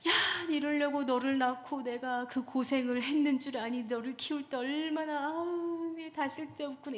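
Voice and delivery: mock long-suffering wail, climbing in pitch; lamenting mother. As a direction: act out a mother bemoaning all she sacrificed. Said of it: "이렇게 한탄하는 말".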